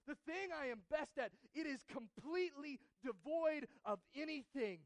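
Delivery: anguished confession. Acted. Isaiah confessing his best work is filthy rags, loud anguish. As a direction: cry out that his best is worthless. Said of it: "And he says".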